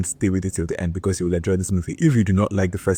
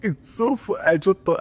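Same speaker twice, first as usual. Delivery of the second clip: laughing; 10 semitones higher than usual. Real, not acted. Cracking up over favourite line.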